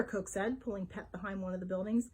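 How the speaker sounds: usual speaking voice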